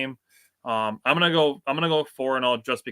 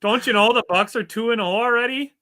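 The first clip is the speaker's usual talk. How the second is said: much higher, louder hammed-up Canadian voice; mock Canadian accent; 11 semitones higher, 6 dB louder than usual. Joking with co-host about Wisconsin fans, mock Canadian accent. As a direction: oversell the Canadian twang. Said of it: "are you guys Canadian".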